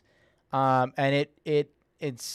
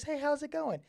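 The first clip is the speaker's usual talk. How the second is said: much higher, chirpy service-desk greeting; customer service voice. Shown as a demonstration. Streamer demos head voice to viewers in a customer service voice. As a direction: sound like a cashier greeting a shopper. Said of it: "like, the customer service voice".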